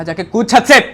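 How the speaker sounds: high, full-force yelled command; furious shout. Retold or imitated. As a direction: scream the order at the boy. Same order repeated as a shout.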